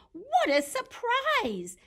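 high, loud exclamation; acted delighted surprise; surprised. Eddie meets an enormous Freddie, surprised.

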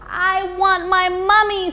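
tiny owl wanting his mummy; baby owl whimper. Acted. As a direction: whine it like the smallest owl. Baby Bill cries for mummy.

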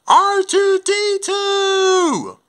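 high, drawn-out yell; calling out. Acted. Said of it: "Let's call R two D two".

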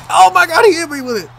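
loud, high cry of disbelief; shocked yell. Real, not acted. Player just hit by a big move, shocked yell. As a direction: yelp as the hit catches you out.

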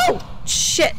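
high startled gasp. Real, not acted. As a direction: jolt at a sudden scare. Gasp at ghost event.

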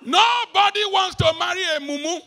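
loud preaching shout. Real, not acted. Preacher to young people, loud shout. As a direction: belt it out to the whole hall.

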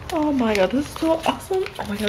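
high squeaky burst of delight; excited squeal. Real, not acted. Fan thrilled opening a card pack, high squeal. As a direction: let it jump way up in joy.

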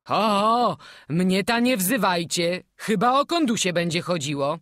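laughing. A narrator's reading. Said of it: "odezwał się ze śmiechem organista".

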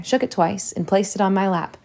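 usual speaking voice

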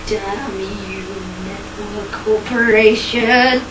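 low, slow villain voice; deep villain curse. Acted.

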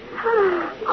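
sharp high gasp of shock; 首吊りの人形を見て息をのむ; startled gasp. Acted. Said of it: "Don't jump like that".

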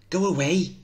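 high frightened scream through the door; terrified high yell. Acted. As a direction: scream at the child to leave. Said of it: "Missus Johnson screaming".

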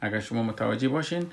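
ordinary talk

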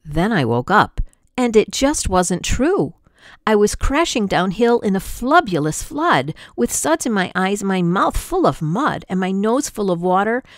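ordinary delivery

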